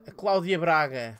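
ordinary delivery

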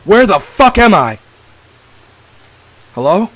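slow, stunned, then loud and high; scared horror game streamer. Real, not acted. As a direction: shaken awake somewhere strange. Waking up in cannibal camp.

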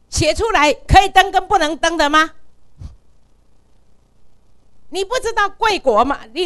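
模仿对方高声怒骂; loud, high furious rant; retold angry tirade. Imitating the journalist's tirade. (高声怒斥质问)

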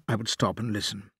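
usual speaking voice

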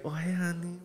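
sweet, soft dancer voice; breathy-free honeyed hello; dancer greeting. Retold or imitated. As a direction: coo it like a stripper greeting a client. Strip club dancer voiced sweetly.

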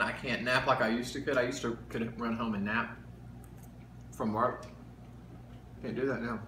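chewing while talking; eating breakfast while speaking; mouth full of food. Real, not acted. Seen 0: Left man bites food from fork at 0.2, then keeps chewing.

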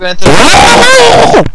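high, loud, slow drawn-out wail; fox wail imitation. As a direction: stretch it into an eerie animal cry. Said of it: "Şeye benziyor biraz".

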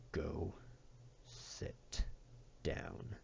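very soft, low, near-whispered order; hushed, firm command. A narrator's reading. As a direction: spell it out quietly, word by word. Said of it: "Matt said in a low voice".

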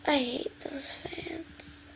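slow, very soft whispered complaint; whispered grumble. Real, not acted. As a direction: breathe the gripe under your breath. Grumbling about the game fans.

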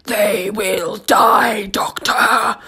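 slow, loud alien snarl; creature shout. Acted. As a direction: roar it out like a monster. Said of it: "the creature replied".

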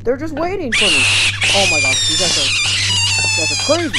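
loud exasperated yelling; exasperated shouting. Real, not acted. Player yelling at monsters blocking his way, loud exasperated shouting. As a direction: yell at the monsters in disbelief.

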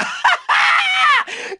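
shrieking high gleeful laugh; high shouted cackle. Acted.

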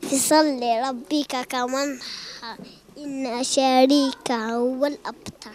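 put-on child reciting a sura in a chanted way; Quran recitation. Boy recites a sura for the presenter, chanted. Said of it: "قولها لنا وليدي".

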